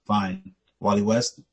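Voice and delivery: understated low superhero voice; Batman. Retold or imitated. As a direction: list the names low and unhurried. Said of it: "and Batman goes".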